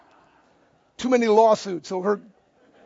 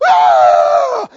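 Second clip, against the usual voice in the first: high, loud crowd yell; high imitated roar of the crowd; crowd's roar; 11 semitones higher, 10 dB louder than usual. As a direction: let out a big wild cheer like a whole audience. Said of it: "everybody goes".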